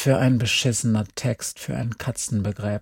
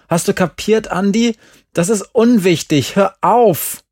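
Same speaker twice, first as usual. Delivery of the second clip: angry; 6 semitones higher, 6 dB louder than usual. A narrator's reading. Glenn snaps at Andi.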